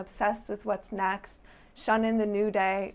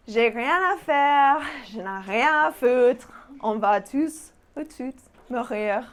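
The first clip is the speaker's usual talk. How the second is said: exasperated French phrases; 3 semitones higher, 4 dB louder than usual. Acted. Performing her French phrases poem, fed up and emphatic. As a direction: sigh out each grumble with dramatic fed-up weight.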